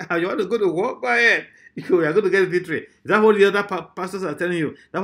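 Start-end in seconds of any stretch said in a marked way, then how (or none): 0.1-2.8 raised, mock-encouraging voice; lightly laughing, insincere encouragement; sarcastic. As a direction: act out a sarcastic go-ahead. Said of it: "because the way the man said".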